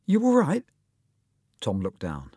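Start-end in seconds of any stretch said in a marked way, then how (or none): 0.1-0.6 high, loud little voice; little schoolboy voice. Acted. Small schoolmate checking on Tom, high little voice. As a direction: pipe up with concern.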